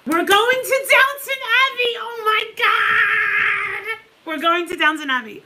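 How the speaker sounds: high, loud replay of the excited cry; reliving the thrill, half crying; retold joyful shriek. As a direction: re-enact the shriek of joy. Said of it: "I'm like".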